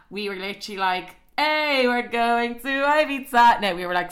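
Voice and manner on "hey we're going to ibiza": high, loud, drawn-out whoop; mock party cheer. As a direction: cheer it slowly like a holiday chant. Mimics mock cheering about the trip.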